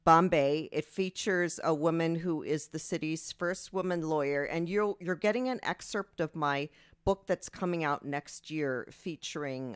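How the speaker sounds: ordinary talk, nothing marked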